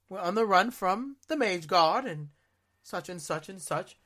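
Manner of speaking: lightly put-on fantasy rogue accent; playing the rogue Mal on the run, light accent; rogue character accent. Recalling staying in character at Medieval Times, rogue accent. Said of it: "talking in an accent like this".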